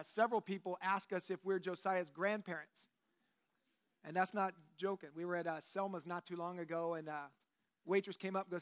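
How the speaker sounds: usual speaking voice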